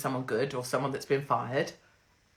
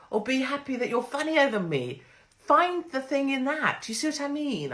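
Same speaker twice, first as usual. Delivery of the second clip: much higher, sing-song coaxing voice; gushing luvvie; 8 semitones higher than usual. Acted. Coaxing an unwilling viewer in a much higher, gushing luvvie voice. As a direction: coo at the reluctant viewer to let go.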